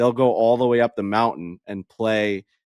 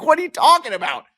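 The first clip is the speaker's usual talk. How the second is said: very high-pitched mock yelp of disbelief; incredulous outburst; 4 dB louder than usual. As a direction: squeal it in hammed-up astonishment. Retells escalating disbelief at Bernie's reveal.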